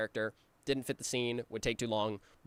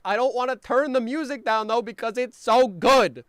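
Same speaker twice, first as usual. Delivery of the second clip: loud, higher whining plea; whiny protest; 11 semitones higher, 12 dB louder than usual. Retold or imitated. Creator refusing to turn the music down, loud whiny plea. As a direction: whine it like a stubborn kid. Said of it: "And I was like".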